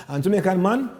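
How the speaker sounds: ordinary talk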